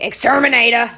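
hammed-up loud shout of a job title; exterminator's call. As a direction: call it out like a New York guy knocking on every door. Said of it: "That's literally what he sounds like".

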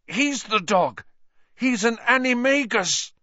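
high, urgent cry of alarm; panicked warning. A narrator's reading. Injured Ron warns in terror.

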